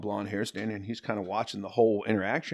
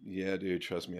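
deadpan fan; 2 semitones lower, 7 dB quieter than usual. Retold or imitated. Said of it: "looking at him like".

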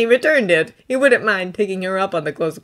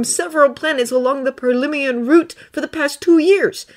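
put-on higher, earnest young Jedi voice; Thonne's voice; 9 semitones higher than usual. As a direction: lift the voice up for Thonne, sincere and pleading. Thonne reveals himself.

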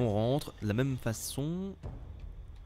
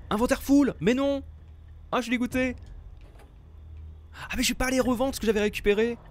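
frustrated outburst; 10 semitones higher than usual. Real, not acted. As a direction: vent the disappointment. Frustrated at full inventory.

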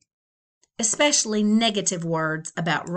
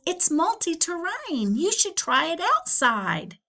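eager salesman; 8 semitones higher than usual. Acted. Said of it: "He says".

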